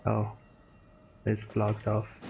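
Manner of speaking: normal talking voice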